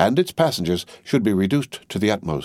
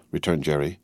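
usual speaking voice

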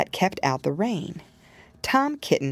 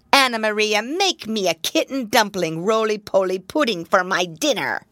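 put-on louder, bossy old rat voice; a greedy old rat giving orders; 4 semitones higher, 4 dB louder than usual. Samuel Whiskers orders dinner.

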